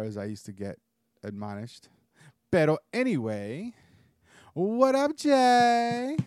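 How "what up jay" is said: high, loud hype greeting; excited greeting. Real, not acted. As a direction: call out the hello with energy. Greeting co-host loudly.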